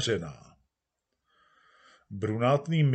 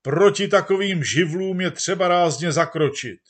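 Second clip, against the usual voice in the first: higher, louder indignant outcry; crowd yelling; 7 semitones higher, 8 dB louder than usual. Acted. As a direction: demand it as an angry mob. Crowd continues its shouting. (rozhořčený dav křičí)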